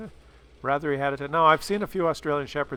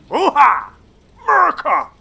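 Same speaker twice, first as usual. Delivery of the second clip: much higher and louder, yelled; patriotic victory shout; 12 semitones higher, 10 dB louder than usual. Real, not acted. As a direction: whoop for America after the win. Celebrating an American tank victory.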